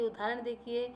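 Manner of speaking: ordinary talk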